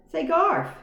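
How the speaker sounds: high, loud little mouse call; squeaky mouse call. A narrator's reading. Mouse calling out to the boy. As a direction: pipe up to get his attention.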